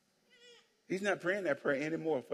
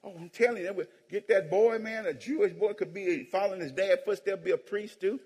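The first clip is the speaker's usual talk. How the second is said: normal talking voice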